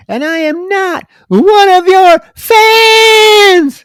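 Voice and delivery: high, slow screaming fan-hating shout; Christina shouting. Retold or imitated. Podcaster quoting Christina screaming at Joan. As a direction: shriek it like a furious daughter. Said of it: "When Christina's like".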